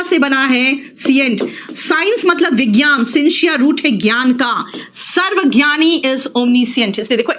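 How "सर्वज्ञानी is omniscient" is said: slow teaching. Shown as a demonstration. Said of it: "teacher spelling it out slowly".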